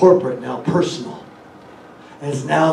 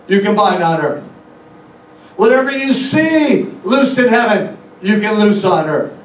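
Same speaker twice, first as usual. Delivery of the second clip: raised, driving pulpit voice; higher, louder sermon cadence building; full-force preaching; 7 semitones higher, 6 dB louder than usual. Acted. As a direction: preach it out loud to the congregation.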